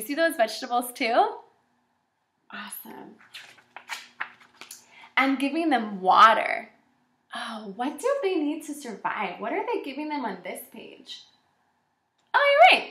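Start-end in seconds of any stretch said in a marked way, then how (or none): 2.5-3.1 soft whisper of praise. Real, not acted. Teacher praising the class quietly, whispered. 12.3-12.9 much higher, louder exclaim; delighted surprise at a right answer; excited affirmation. Real, not acted. Teacher praising a pupil's answer, excited and high.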